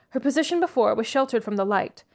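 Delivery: usual speaking voice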